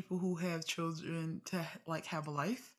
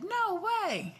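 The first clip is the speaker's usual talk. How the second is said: disbelieving; 13 semitones higher, 6 dB louder than usual. Real, not acted. Reacting to content wife story.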